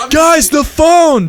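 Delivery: high, loud, stretched-out shout; screamed alert. A narrator's reading. Dave alerts the arguing group, screamed. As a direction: shout urgently to stop everyone. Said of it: "Dave screamed".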